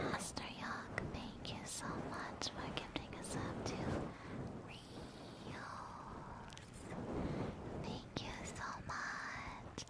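soft ASMR-style thank you; whispered thanks. Acted. ASMR streamer thanking a gifter in a whisper.

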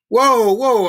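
loud, high roar. A narrator's reading. Guard stepping in to stop the beating, roaring. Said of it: "I roared".

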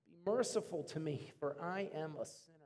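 sorrowful prayer. A narrator's reading. Tax collector begging God for mercy, hushed and humble. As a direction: pray it low and broken. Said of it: "beat his chest with sorrow".

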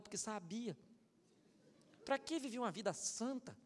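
ordinary talk

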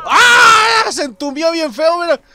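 very high, loud outburst; scream, then keep talking up high; startled scream. Real, not acted. Player caught in a gunfight, startled scream.

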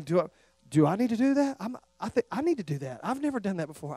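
hushed inner voice. Retold or imitated. Imagined convicted listener.

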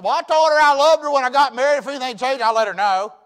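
loud, high hillbilly farmer voice; loud mock hillbilly farmer; country farmer. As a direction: ham up the stubborn country husband. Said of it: "country hillbilly farmer".

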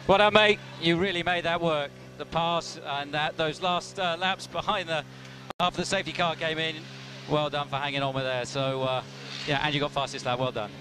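calm congratulations over pit radio; race car onboard radio. Real, not acted. Team boss congratulating over radio.

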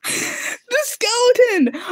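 high, drawn-out cry of fright; frightened wail. Real, not acted. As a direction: wail it as the skeleton appears. Recalls the fright.

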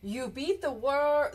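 plain reading voice

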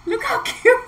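high and giggling through cute; laughing delight. Real, not acted. Showing viewers the cute pot, giggling high. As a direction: gush and laugh mid-phrase.

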